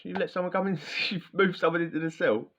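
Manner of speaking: high, incredulous mimicked Cody; shocked Cody. Cody back on the wing, high and disbelieving. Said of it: "He's like".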